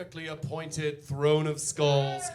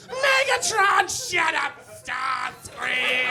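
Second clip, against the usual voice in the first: high, slow, loud diva yell; drag queen screech; 7 semitones higher, 5 dB louder, much slower than usual. Acted. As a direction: scream it like a catty queen backstage. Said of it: "screaming at each other like they're backstage at a drag show".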